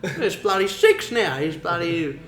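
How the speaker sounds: put-on high, loud, cranky old farmer laughing through it; grumpy outback bloke. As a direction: yell it like an annoyed bushman spotting late campers. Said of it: "making a character out of this dude".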